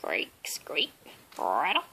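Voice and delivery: whispered creak and squeak; creaky bed noise. A narrator's reading.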